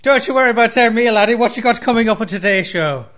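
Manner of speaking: loud, hammed-up Irish brogue; a lightly done Irish accent, loud and cheery; horrible Irish accent. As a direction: play a cheesy Irish lad named O'Neill. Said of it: "a horrible accent".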